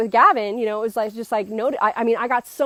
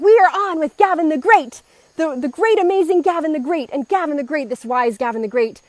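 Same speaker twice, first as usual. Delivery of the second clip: hyped intro; 6 semitones higher, 5 dB louder than usual. Acted. Hyping up Gavin the Great.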